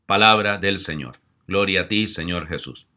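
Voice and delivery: loud ritual closing; liturgical acclamation. Acted. Priest closing the Gospel for the congregation. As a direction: intone it as the reading's end.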